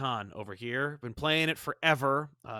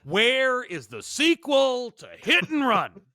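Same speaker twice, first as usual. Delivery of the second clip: high, loud, drawn-out demand; mock outraged plea; 10 semitones higher, 5 dB louder, slower than usual. Fan pleading for a game sequel, mock outrage. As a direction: wail it slowly like a fan denied for years.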